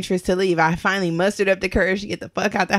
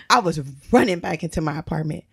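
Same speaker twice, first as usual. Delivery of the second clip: ordinary talk, nothing marked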